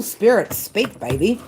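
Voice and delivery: normal talking voice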